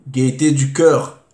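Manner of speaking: ordinary talk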